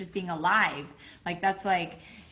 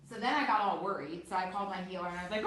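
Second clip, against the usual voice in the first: ordinary delivery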